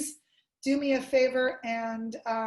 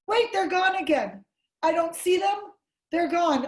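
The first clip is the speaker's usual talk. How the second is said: raised, loud pretend puzzlement; mock bewilderment; 5 semitones higher, 6 dB louder than usual. Shown as a demonstration. Lecturer pretending people vanished in peekaboo, mock bewilderment. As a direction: act baffled at another disappearance. Acting out peekaboo, gone again.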